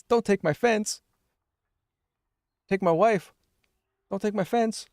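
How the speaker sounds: high-pitched joke voice; mock pleading. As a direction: squeal it as a silly gag. Joking play on take offense.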